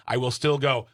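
normal talking voice

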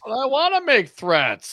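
high, drawn-out mock complaint; mock protest. Co-host mimicking the defendant balking at bail terms, mock protest. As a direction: play a defendant whining in protest.

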